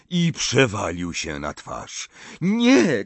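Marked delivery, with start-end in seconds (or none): 2.4-3.0 high, loud cry of alarm; shocked shout. A narrator's reading. Harry seeing Dumbledore collapse, shocked shout. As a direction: cry out as he falls. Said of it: "krzyknął Harry".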